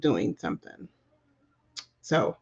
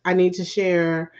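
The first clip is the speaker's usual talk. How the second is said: normal talking voice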